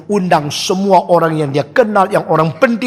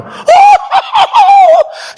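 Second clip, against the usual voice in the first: booming mock divine laugh; 5 dB louder than usual. Gods laughing, heavens shaking.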